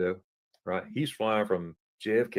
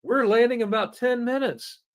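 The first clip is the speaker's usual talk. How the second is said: quoting friend; 13 semitones higher, 4 dB louder than usual. Retold or imitated. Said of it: "He goes".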